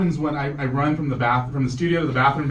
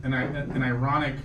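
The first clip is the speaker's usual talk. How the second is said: ordinary delivery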